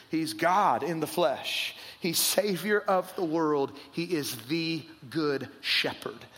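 slow, weighted declaration. Acted. Pastor declares who Jesus is, slow and weighty. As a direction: drop and slow on each phrase.

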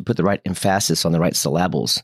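"emphasis" stressed em-FASS-is, "syllables" as suh-LAB-bles; teasingly misplace the stress: em-FASS-is, suh-LAB-bles; wrong-syllable stress. Shown as a demonstration. Student teases teacher about accents, "emphasis" and "syllables" stressed on second syllable. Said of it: "I kinda teased him".

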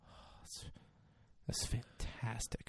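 soft, breathy near-whisper of amazement; overwhelmed mock reverence, barely voiced; hushed awe. Acting out boss's hushed awe at dinosaur.

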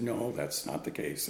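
low, slow, soft voice for the director; training director. Retold or imitated. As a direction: drop it low and slow, calmly denying. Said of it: "And the training director said".

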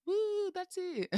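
high, drawn-out mock whoop; cheering audience. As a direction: cheer slowly like an adoring crowd. Imitating the audience's ovation.

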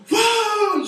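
high, loud whoop of joy; excited whoa. Retold or imitated. A crowd member cheering Jesus with a loud whoop. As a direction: cheer like the awkward clapping guy.

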